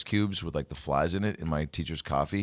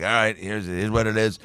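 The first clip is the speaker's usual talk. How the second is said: abrupt teacher; 4 semitones higher, 3 dB louder than usual. Retold or imitated. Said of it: "a very just abrupt teaching style".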